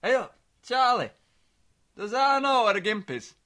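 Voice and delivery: a hint of a baffled old father; dad's voice. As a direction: son imitates dad calling late with a question. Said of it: "He called me again last night".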